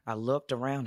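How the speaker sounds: ordinary talk, nothing marked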